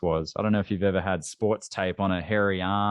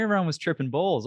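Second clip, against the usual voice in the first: ordinary delivery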